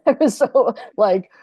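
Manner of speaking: laughing through the words; giggling at her own wild night. Real, not acted.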